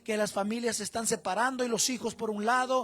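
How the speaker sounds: loud, rousing sermon delivery; fiery preaching. Acted. Pastor urging the men to be strong fathers, fiery preaching. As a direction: preach it at full force to the congregation.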